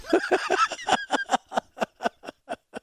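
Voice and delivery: high wheezy giggle; laughing. Real, not acted. Host cracking up at his own joke, laughing. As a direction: break into a long, high laugh. Joking about minorities struggling along.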